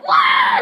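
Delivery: very high, loud crying wail; hammed-up bawling shriek; mock wail. Lisa hit by a dodgeball, high mock wail.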